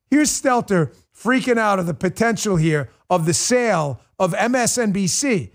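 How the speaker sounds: ordinary delivery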